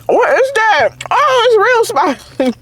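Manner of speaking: high, startled spice reaction; shocked. Real, not acted. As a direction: caught off guard by the heat. Reacting to spicy pepper.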